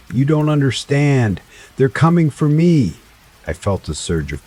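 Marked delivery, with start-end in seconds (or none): 0.1-1.4 high, desperate and scared; frightened. A narrator's reading. Frightened woman pleading. 1.8-3.0 high, desperate and loud; frightened. A narrator's reading. Woman's original frightened plea.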